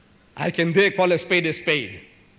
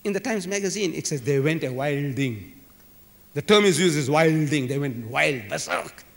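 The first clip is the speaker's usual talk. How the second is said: ordinary delivery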